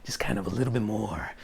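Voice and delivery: low, soft voice, very into himself; self-absorbed creature. Acted. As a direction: sink low and savour it, pleased with yourself. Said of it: "he seems to be a lot more into himself".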